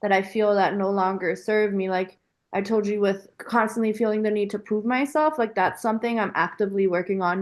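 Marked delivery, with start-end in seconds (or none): none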